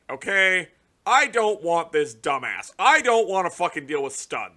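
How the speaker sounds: usual speaking voice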